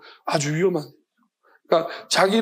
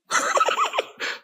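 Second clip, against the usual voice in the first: a squeaky, silent burst of laughter; high airless laugh; 4 dB quieter than usual. Real, not acted. Laughing at cancer prayer joke. (높고 숨죽인 웃음)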